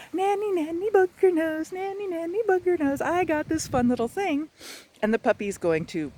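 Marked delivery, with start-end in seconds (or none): 0.1-4.5 high, loud teasing singsong. Shown as a demonstration. Owner taunting the puppy with a treat, high teasing singsong. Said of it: "and go".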